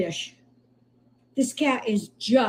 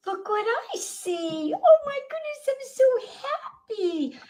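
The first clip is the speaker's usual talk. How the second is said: much higher, thrilled, drawn-out cooing; delighted squeal; slower than usual. Real, not acted. Rescuer overjoyed seeing the recovering kitten, high delighted coo. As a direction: gush with joy over the kitten.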